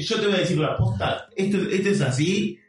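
cocky know-it-all. Acted. Friend imitating an Argentine who gets worked up, cocky know-it-all.

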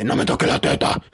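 whispery imitation of a husky host; a hint of a husky man's voice; deep mannish voice. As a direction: push it down like a gruff man. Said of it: "una voz bien gruesa de hombre".

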